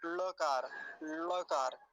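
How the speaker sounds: लकार with the ल curled back toward ड; retroflex ळ demo. Shown as a demonstration. Teacher demonstrates the special ळ sound to students. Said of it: "कह के ही बताया जाएगा".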